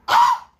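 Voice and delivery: sharp, very high startled cry; sudden high-pitched gasp of shock. Real, not acted. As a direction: yelp out in disbelief. Shocked exclamation.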